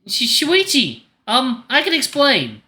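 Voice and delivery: high, stammering panic; flustered alarm. Acted. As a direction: blurt an excuse when caught. Caught in compromising position by brother.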